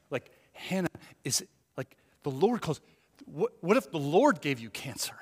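usual speaking voice

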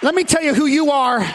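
usual speaking voice

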